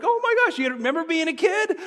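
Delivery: squealing high, thrilled "Oh my gosh"; giddy freaking out. Retold or imitated. Boys in the furnace, overjoyed, squealing with glee. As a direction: gush like an overexcited kid. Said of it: "freaking out like".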